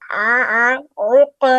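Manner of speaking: throaty ain in आ and उक, pushed and loud; prominent ain. Shown as a demonstration. Teacher showing students a prominent ain. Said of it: "आइन को prominent करने की कोशिश करें".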